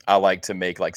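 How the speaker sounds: usual speaking voice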